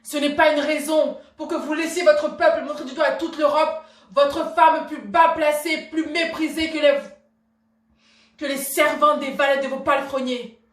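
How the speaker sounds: loud, high, vehement fury; outraged tirade. Acted. Wife railing at husband over public insults, outraged tirade. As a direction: drive the outrage out at full voice.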